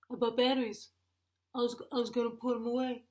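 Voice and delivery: sheepish dopey husband; mock husband voice. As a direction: make a lame excuse as the husband. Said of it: "that same husband voice".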